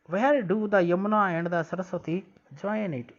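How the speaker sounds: slow, careful read-aloud of English text; slow textbook reading. Shown as a demonstration. Teacher reading the textbook line slowly to pupils. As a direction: read each word out slowly for the class.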